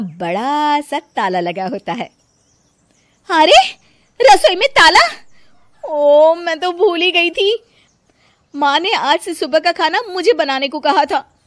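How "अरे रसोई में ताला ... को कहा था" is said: much higher and loud in disbelief; shocked. Acted. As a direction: gasp at the locked kitchen. Shocked at locked kitchen.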